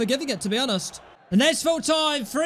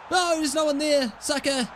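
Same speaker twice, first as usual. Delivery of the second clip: normal talking voice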